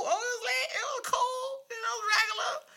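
high, sing-song fake-innocent answer; innocent kid act. Telling mom school was fine, a high fake-innocent voice. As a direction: play the sweet kid who did nothing.

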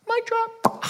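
high squeaky exclaim; mic drop. Acted. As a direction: toss off the punchline in a squeak. Punchline after Jesus' question.

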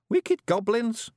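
high, slow, careful prompt; feed the line in a tiny Scots voice; wee Scottish prompter. A narrator's reading. Voices Billy prompting Rob.

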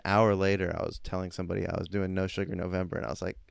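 ordinary talk, nothing marked

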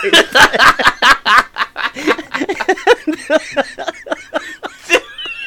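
long, high-pitched real laughter; laughing fit. Real, not acted. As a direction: burst out laughing and keep going. Cracks up together.

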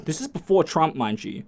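normal talking voice

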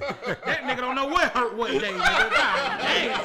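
high laughing, words breaking up; cracking up mid-sentence. Real, not acted. Mocking Ben Simmons' injuries.

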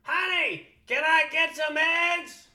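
high, loud, slow squawking request; Gilbert Gottfried at home. Pretend. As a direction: ask for breakfast in a screeching squawk. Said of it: "if he talked like that in his normal voice".

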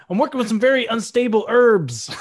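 brother voice. Retold or imitated. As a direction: play the brother as a goofy cook. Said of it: "when the kitchen blows up".

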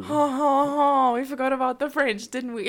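loud, high hammed-up French accent; mock French accent. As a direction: ham it up like a teasing French caricature. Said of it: "Why'd you do it in a French accent?".

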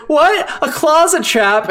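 hammed-up disbelief at the trap; mock surprise. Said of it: "she's like".

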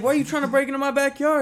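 high, loud, rapid-fire challenge; acting out a heated confrontation; yelled accusation. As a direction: accuse the intruder at a fast shout. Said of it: "this yelling match".

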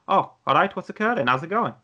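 chirpy Welsh "what's occurring"; Welsh accent. Retold or imitated. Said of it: "sound more Welsh".

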